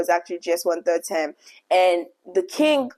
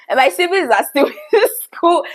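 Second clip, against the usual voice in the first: high laughing through the words; 10 semitones higher, 6 dB louder than usual. Real, not acted. Vlogger laughing to viewers about her siblings, high and loud. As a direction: giggle the line out, much higher and louder.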